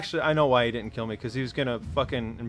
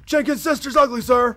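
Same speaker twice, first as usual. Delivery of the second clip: shouting recruit; 10 semitones higher, 7 dB louder than usual. Pretend. Recruit answering drill sergeant.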